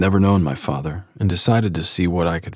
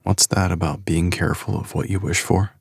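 ordinary delivery

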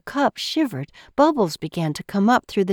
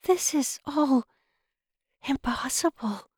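awed whisper; 6 semitones higher, 5 dB quieter than usual. A narrator's reading. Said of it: "Belle whispered".

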